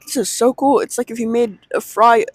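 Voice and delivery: lifted pitch, a touch of wonder; retold excitement. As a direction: act out your delighted first reaction. Retelling first discovery of the snack.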